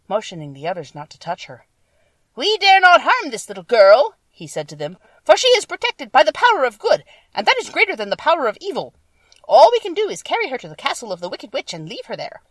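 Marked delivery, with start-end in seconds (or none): 2.4-4.2 high, very loud creature leader; monkey leader's voice. A narrator's reading. Winged monkey leader holding the others back from Dorothy, loud high warning. 5.2-12.4 high, very loud creature leader; monkey leader's voice. A narrator's reading. Winged monkey leader holding the others back from Dorothy, loud high warning.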